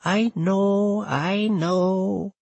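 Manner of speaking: put-on high, slow, quavering old woman's voice; a frail old grandmother, voice trembling. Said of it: "in Nana's quavering voice".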